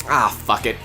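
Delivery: normal talking voice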